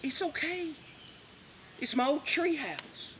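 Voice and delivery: a hint of a father hushing his baby girl; tender to his daughter. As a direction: soothe a little girl, softly.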